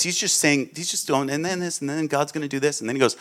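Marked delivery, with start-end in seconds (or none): 1.3-2.8 casual prophet. Retold or imitated. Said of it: "he's just talking like this".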